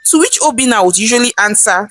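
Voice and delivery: ordinary delivery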